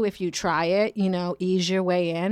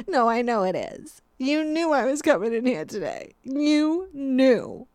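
hammed-up, higher-pitched hurt customer; mock wounded accusation; 5 semitones higher than usual. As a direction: accuse them as if personally betrayed. Acted customer accuses the barista.

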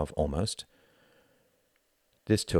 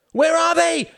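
high, piercing shriek; 12 dB louder than usual. Acted. Ruth shrieking for her children at the burning house. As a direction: shriek it out in panic. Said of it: "shrieked Ruth".

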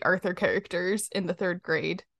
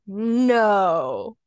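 mock Buster; 3 semitones higher, 4 dB louder, much slower than usual. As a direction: play it as fake-innocent sarcasm. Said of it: "And Buster's like".

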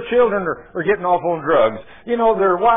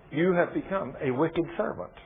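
usual speaking voice

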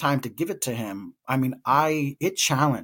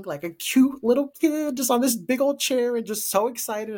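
high-pitched adoring gush; cooing; 11 semitones higher than usual. Retold or imitated. Aunt describing excited little nephew on big chair, cooing. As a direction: go high over how cute the kid is.

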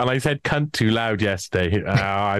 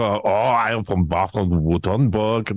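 put-on slow, heavy German accent; Klaus Schwab impression; 2 semitones lower, slower than usual. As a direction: drag it out like a Swabian official. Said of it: "That's my Klaus Schwab".

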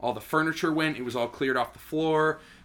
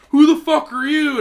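high, loud angry demand; angry neighbor; 13 semitones higher, 10 dB louder than usual. Retold or imitated. Neighbor confronting the renter, high loud angry demand. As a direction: bark it at the stranger. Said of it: "And he's like".

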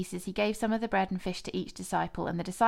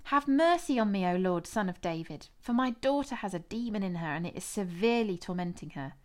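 plain reading voice

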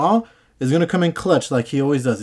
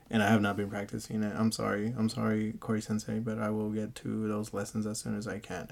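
quiet; 4 semitones lower, 13 dB quieter than usual. Real, not acted. Sheepish apology to her teacher.

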